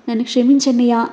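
ordinary delivery, nothing marked

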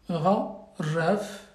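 ordinary delivery

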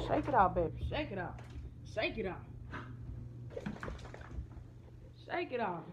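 low, soft coaxing to a dog. Real, not acted. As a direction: gently coax the pet. Soothing dog.

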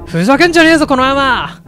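roaring in rage, pitch way up; furious shout. Acted. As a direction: bellow it like a violent thug. Attacker screams at girl.

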